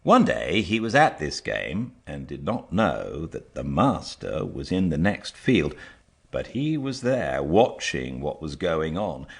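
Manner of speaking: usual speaking voice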